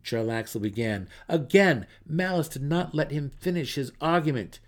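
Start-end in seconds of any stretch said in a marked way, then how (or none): none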